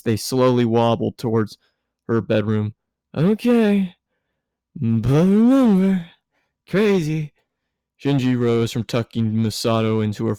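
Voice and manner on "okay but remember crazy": high, drawn-out tipsy warning; drunk Misato. A narrator's reading. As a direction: wag a drunken finger at him. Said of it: "drunk Misato parting words".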